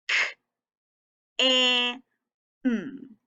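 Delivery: K, A, M as separate sounds with gaps; teacher segmenting K, A, M sound by sound. Shown as a demonstration. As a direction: hold the A, keep K and M apart. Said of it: "Let's tap it out".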